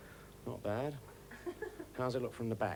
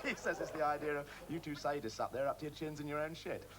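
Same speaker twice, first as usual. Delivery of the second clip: usual speaking voice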